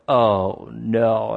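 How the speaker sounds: retold dismay. Runner realising his mistake, retold.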